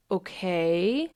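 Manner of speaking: slow, drawn-out rising okay; voice of the emotion. Acted. Emotion replying to reassurance, cautious acceptance. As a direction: accept it cautiously, stretching the word. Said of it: "And the emotion goes".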